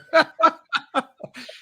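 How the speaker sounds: a big, high burst of laughter; hearty laugh. Real, not acted. Telling the host his old story, bursting out laughing. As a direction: laugh out loud at the punchline.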